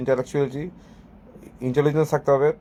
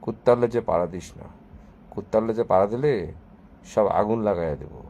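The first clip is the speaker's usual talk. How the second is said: lower-pitched, subdued mock-guru threat; calm guru threat; 5 semitones lower, 5 dB quieter than usual. Shown as a demonstration. Showing viewers how a guru scolds, calm and low. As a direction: deliver the threat with serene restraint. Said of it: "এভাবে বলবো".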